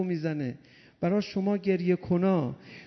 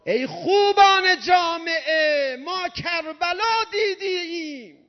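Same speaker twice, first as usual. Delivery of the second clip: high, loud preaching cry to the crowd; loud call; 9 semitones higher, 4 dB louder than usual. Real, not acted. Preacher calling out to the crowd, high and loud. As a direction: lift the voice and call out to the congregation.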